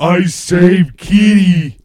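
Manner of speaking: slow, loud simpleton hero voice; eroe sempliciotto. Acted. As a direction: announce it proudly, dragging each word. Player voices slow-witted hero Toot.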